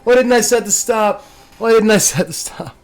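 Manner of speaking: high-pitched wail of regret; exasperated self-reproach. Real, not acted. Player realizing he never set the stop, exasperated wail.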